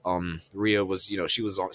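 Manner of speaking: ordinary talk, nothing marked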